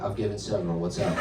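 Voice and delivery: low, soft, casual boast; cool nonchalance. Acted. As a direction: toss it off low like a seasoned pro. Boasts coolly to friends in story.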